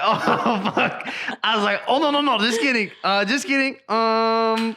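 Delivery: high, laughing mock panic; comic high-pitched scramble to take it back; panicked backtracking. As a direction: squeak out a hasty retraction. Retells panicked backtracking to upset partner.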